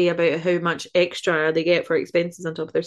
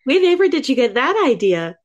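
mock-innocent sarcastic question, higher and louder; 6 semitones higher, 4 dB louder than usual. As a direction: feign surprise to tease your friend. Teasing co-host about generalising Americans.

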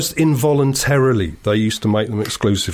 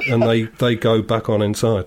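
normal talking voice

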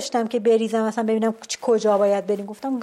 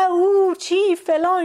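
mock foreign chatter; 7 semitones higher, 5 dB louder, slower than usual. Imitating lost Russians' commotion.

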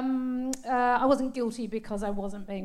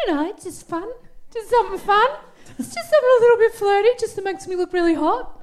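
flirty; 10 semitones higher, 6 dB louder than usual. Acted. Flirty costume description, high loud.

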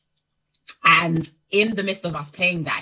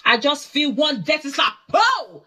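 shocked retelling; 9 semitones higher, slower than usual. Retold or imitated. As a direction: hit boom and slap hard. Retelling a sudden slap.

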